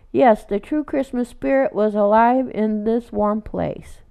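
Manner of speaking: plain reading voice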